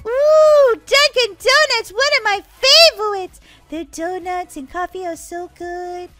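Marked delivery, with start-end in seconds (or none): 0.1-3.4 loud, high squeal of delight; thrilled at a favorite treat; excited. Acted. Delighted by sister's suggestion.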